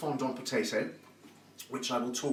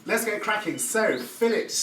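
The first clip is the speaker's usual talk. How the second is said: upbeat rally; 9 semitones higher, 6 dB louder than usual. Real, not acted. Back to cooking, rallying.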